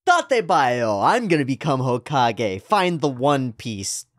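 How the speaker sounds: loud eager anime hero; shonen hero. Pretend. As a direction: shout it like a shonen protagonist. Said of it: "shonen protagonist speech".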